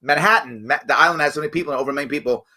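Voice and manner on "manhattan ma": usual speaking voice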